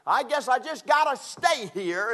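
high, loud, resigned whine; imitating a resigned missionary, high and loud. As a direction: sound stuck and put-upon. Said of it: "and he said".

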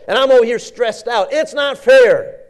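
high, loud whining complaint; petulant sister complaining loudly; whiny Martha. Acted. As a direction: whine it like a put-upon sister. Preacher voicing Martha's complaint.

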